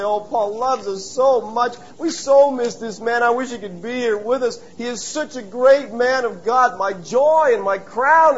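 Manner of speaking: teary ladies. Pretend. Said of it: "Euodia and Syntyche are crying".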